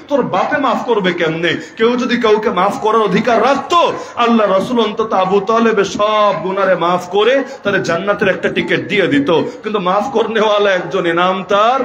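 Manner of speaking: usual speaking voice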